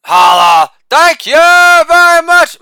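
Holla barked loud, then thank you very much stretched slow and very high; shouted catchphrase. Acted. Comic to audience, hammed-up sign-off, loud high drawn-out shout. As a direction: belt the sign-off like a showman milking applause.

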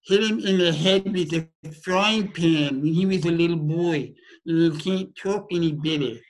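lightly voiced boy with a speech impediment, words a bit blurred; a hint of a young boy who can't talk any better; twelve-year-old with a speech impediment. Shown as a demonstration. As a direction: show the kid's impeded way of talking. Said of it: "I'll just show you".

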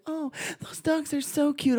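put-on high, slow girlish coo; gushing classmate. Classmate coos over the police dogs, high gushing imitation.

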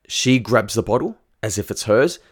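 ordinary delivery

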